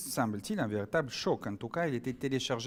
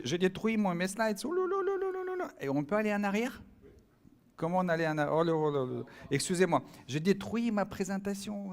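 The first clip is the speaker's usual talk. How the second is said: high-pitched "oh là là" fluster; real flustered dismay; 8 semitones higher than usual. Real, not acted. Speaker apologising to the audience for wrecking the slides, flustered. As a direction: caught out and flustered, pitch jumping up. Slide remote fails mid-talk.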